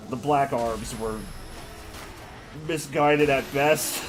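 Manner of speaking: cartoon hero voice. Correcting in Sonic voice.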